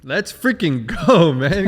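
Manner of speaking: loud, slow drawn-out cheer of celebration; big slow celebratory shout; excited cheer. Real, not acted. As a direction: hype him up, stretch every word. Host cheering guest's sobriety.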